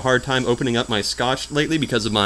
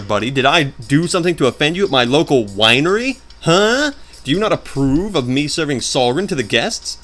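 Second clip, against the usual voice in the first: pitched-up, needling questions to the boss; mock confrontation; 4 semitones higher than usual. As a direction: pick a playful fight with the game villain.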